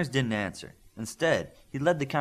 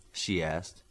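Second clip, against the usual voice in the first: ordinary delivery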